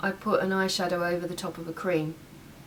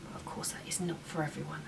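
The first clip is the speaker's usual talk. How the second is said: whispering; 3 semitones lower, 10 dB quieter than usual. Real, not acted. Whispered aside while applying.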